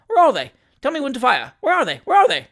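very high, loud, frantic repetitions; highest excitement. Acted. Novice shooter turning round for the birds, highest excitement. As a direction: pile up frantic high-pitched questions. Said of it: "in a state of the highest excitement".

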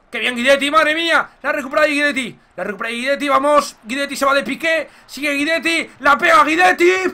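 rising match-call excitement; excited commentary. Real, not acted. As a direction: follow the run as it builds. Counterattack play-by-play.

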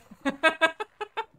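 high, loud mock cartoon laugh; Peter Griffin laugh. Streamer trying his own Peter laugh on request, high cartoon laugh. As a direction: try a goofy cartoon laugh, high-pitched. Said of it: "What do you got?".

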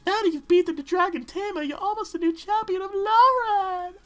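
very high, loud cartoon squeak; squeaky put-on voice. Player reading the game text in a silly squeaky voice.

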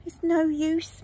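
ordinary delivery, nothing marked

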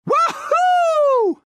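very high, loud "woohoo"; victory whoop. Acted. Winner celebrating, jubilant whoop. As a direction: let out a jubilant holler.

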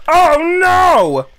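high, loud shocked yelp; alarmed cry. Real, not acted. Seeing the snake fall, loud high alarmed yelp.

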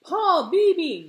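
high, loud hail across water; calling out. Acted. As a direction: shout the name to someone far off. Grandpa calls across the water.

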